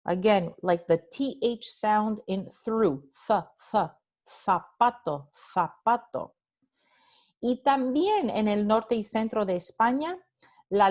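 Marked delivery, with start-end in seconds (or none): 3.3-6.4 zapato with the z as a lisped th, tongue between teeth; Spanish th in zapato. Shown as a demonstration. Teacher modelling northern Spain pronunciation, th in zapato. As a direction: say zapato with Castilian th. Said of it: "esta palabra se pronuncia zapato".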